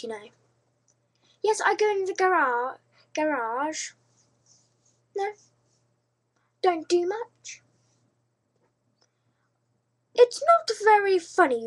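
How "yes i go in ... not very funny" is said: put-on, exaggerated English accent, higher pitched. Shown as a demonstration. YouTuber showing off her very English accent to Shane. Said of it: "talk in a very English accent".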